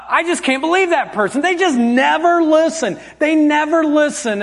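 high-pitched, exasperated griping; mock complaining. Preacher acting out his judgment of poor listeners, mock complaining. Acted complaint about other people.

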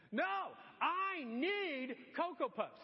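put-on child refusing to give up the cereal; insistent toddler. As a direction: stretch it out, demanding.

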